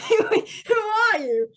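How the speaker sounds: loud, high, drawn-out British caricature; hammed-up British bewilderment; mock British accent. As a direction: demand it as an outraged cartoon Brit. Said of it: "'Cause he's apparently British now".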